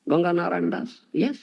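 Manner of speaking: ordinary talk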